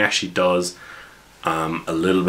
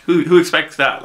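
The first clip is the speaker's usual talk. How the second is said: much higher, louder who expects that; incredulous delight; 11 semitones higher, 6 dB louder than usual. Retold or imitated. GM marvelling at the silly call sign, high and louder. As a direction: marvel at how absurd it is.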